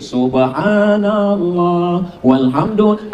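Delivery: slow, drawn-out coquettish dhikr; mock sultry. Preacher parodies women chanting dhikr to men, mock sultry. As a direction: imitate a woman purring through devotional phrases. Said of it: "bersuara mendayu-dayu, mendesak-desak".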